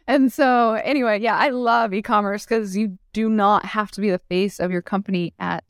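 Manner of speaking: ordinary talk, nothing marked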